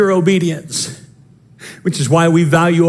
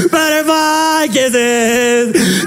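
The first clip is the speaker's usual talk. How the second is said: tearful laughing singsong; 7 semitones higher, much slower than usual. Retold or imitated. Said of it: "we're like".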